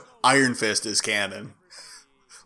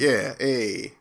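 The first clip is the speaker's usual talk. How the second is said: put-on Brooklyn accent; 2 semitones lower than usual. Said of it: "ridiculous Brooklyn accent".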